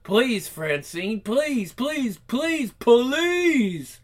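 whiny begging. Acted. Freddy begging.

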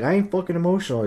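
very high, fast, loud mock protest; defensive clerk. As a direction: squeal a denial like a cornered clerk.

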